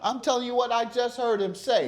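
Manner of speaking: high-pitched, exasperated insistence; testy, high-pitched correction; insistent scolding. Real, not acted. Judge overrides interrupting litigant.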